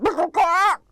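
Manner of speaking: high, loud sputtering squawk; chicken squawk. Shown as a demonstration. Actor demoing the rooster coming out of the water, loud high squawk. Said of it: "he's gonna sound like chickens".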